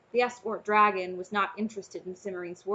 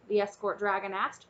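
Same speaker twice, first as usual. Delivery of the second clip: ordinary delivery, nothing marked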